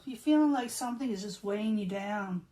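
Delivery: ordinary talk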